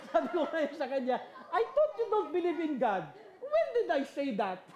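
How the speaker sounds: high, loud, laughing retort. Real, not acted. Father teasing his son about believing, laughing throughout. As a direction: laugh as you tease him. Laughing retelling son's reversal.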